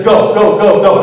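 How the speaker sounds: loud, rushed order to drive off; urgent command. Retold or imitated. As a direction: bark it like a carjacker in a hurry. Carjacker orders him to drive.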